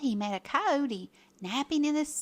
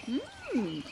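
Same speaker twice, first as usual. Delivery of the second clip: higher, eager herd voice; cattle's cry; 4 semitones higher, slower than usual. A narrator's reading. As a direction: cry out for a treat. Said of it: "they all cried".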